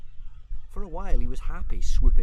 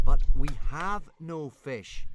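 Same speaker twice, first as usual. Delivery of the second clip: slow, measured, pitched higher; firm but pleasant; 6 semitones higher, slower than usual. A narrator's reading. Hiccup tries to master his dragon, firm and pleasant. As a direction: spell it out slowly and politely. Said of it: "make his voice sound firm but pleasant".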